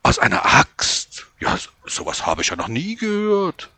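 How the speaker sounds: put-on high, astonished old woman. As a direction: gasp it out in disbelief. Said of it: "schlug vor Verwunderung die Hände zusammen".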